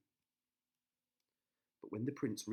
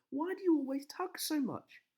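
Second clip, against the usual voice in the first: put-on high, loud girlish complaint; a princess whining at the witch, high and loud; high, loud protest; Rapunzel complaining; 8 dB louder than usual. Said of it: "cried Rapunzel".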